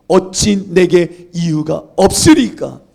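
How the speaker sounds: 어찌 내게 이유가 없으리까, slow, loud and drawn out; slow loud cry. Shown as a demonstration. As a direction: cry the question out slowly, full voice. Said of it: "저는 할 수만 있다면 매일 이 질문을 묻습니다". (크고 느리게 외치는 질문)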